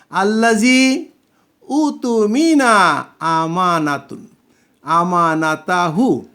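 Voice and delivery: slow, drawn-out Arabic words উতুমিনা আমানাতুন; slow recitation. Shown as a demonstration. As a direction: recite each syllable slowly like a teacher. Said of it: "reads Arabic verse slowly".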